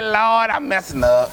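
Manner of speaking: slow, low, half-tearful plea; tearing up. Retold or imitated. Pastor moved to tears confessing to the Lord, tearful. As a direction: let the voice catch a little as you confess. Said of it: "about to start tearing up".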